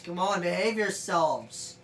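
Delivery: ordinary delivery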